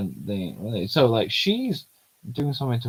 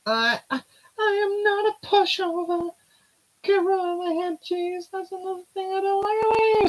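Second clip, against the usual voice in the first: put-on bright girlish character voice, much higher; high heroine voice; 4 dB louder than usual. Voicing the heroine asking where the trip goes, high girlish voice. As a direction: play the young heroine, light and high.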